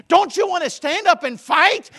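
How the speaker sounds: loud, high fiery preaching; thundering pulpit cry; preaching shout. Acted. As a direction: rouse them to fight, full voice. Preacher shouting at full force.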